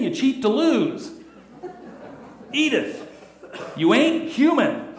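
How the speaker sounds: hammed-up Archie Bunker mocking a saint. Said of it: "saying to his wife".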